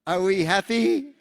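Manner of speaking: high, loud, slow and laughing; camel steward voice. Retold or imitated. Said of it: "he goes".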